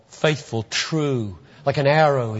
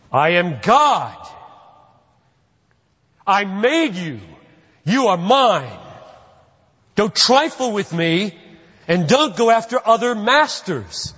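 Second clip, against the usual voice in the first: commanding voice of God, driven home; forceful; 6 semitones higher, 4 dB louder than usual. Acted. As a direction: thunder it like God laying down the law, high and emphatic. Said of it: "So I say it loud, I say it forcefully".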